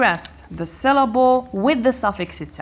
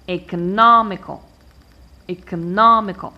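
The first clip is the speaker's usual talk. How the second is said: economical with a loud, strong "no"; stress on third-last syllable; 7 dB louder than usual. Shown as a demonstration. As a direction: punch the "no" in economical. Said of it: "I stress the third syllable".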